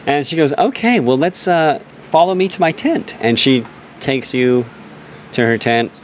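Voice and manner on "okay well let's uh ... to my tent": put-on higher-pitched girly elf woman. Elf druid inviting the dwarf to her tent, girly voice. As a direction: lift it into a girly character voice. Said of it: "More immersive voice. Girly".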